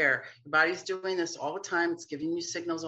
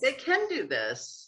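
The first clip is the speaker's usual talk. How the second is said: higher, slow, marvelling; amazed admiration; 8 semitones higher, slower than usual. Retold or imitated. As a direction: stretch it out in wonder at the kids. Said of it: "And you're like".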